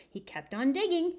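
ordinary delivery

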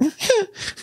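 very high, soft falsetto moan; comic high-pitched moaning noise; imitated moan. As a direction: mimic a stifled squeaky moan. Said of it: "making those noises".

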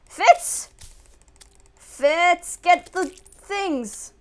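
high, loud urgent calling; shouted orders. Real, not acted. Player ordering a helper to attack, high urgent shouting. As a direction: holler for help from a sidekick.

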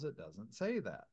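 usual speaking voice

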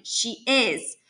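mildly sputtered insistence; insist, a touch flustered; understated sputter of protest. Acted. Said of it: "she sputters".

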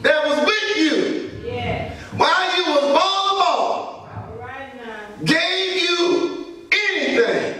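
slow, shouted sermon cadence; drawn-out preacher's cry with long pauses; preaching at full force. Acted. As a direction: hammer each line home to the congregation.

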